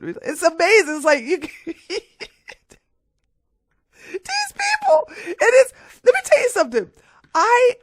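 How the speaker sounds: very high, loud, breaking into laughter; can barely talk for laughing; laughing in disbelief. Real, not acted.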